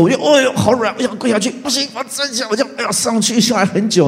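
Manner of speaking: high, flustered struggle; 高声慌乱地描述. Retold or imitated. Knees buckling during prayer.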